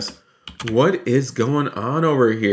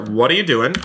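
ordinary talk

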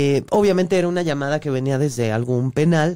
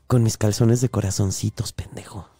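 low taunting voice; 8 semitones lower than usual. Retold or imitated. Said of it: "Y yo".

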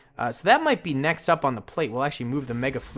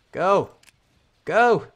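urgent cheering; 5 semitones higher, much slower than usual. Real, not acted. Urging troops to sprint.

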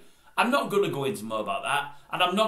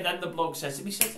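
ordinary talk

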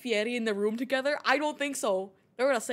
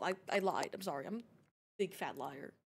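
normal talking voice

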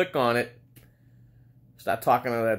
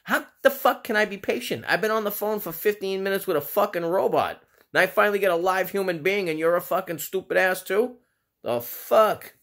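exasperated rant; 5 semitones higher than usual. Retold or imitated. Caller fed up after fifteen minutes on hold, yelling at the agent. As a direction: vent it all at the agent. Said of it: "I'm like".

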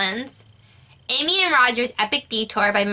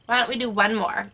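ordinary delivery

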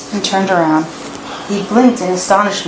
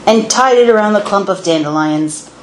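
ordinary delivery, nothing marked

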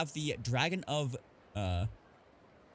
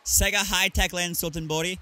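usual speaking voice

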